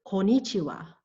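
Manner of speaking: "konnichiwa" run together as four syllables, ko-ni-chi-wa; missing first N. Shown as a demonstration. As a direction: mispronounce "konnichiwa" with only one n. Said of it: "it is missing the first N".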